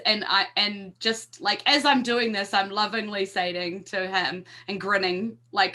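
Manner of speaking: ordinary talk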